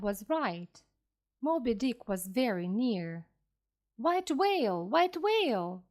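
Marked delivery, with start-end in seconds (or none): 4.0-5.8 narrated captain's call, higher pitched; shouting. A narrator's reading. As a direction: call it out with raised pitch, moderately loud. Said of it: "the captain shouted".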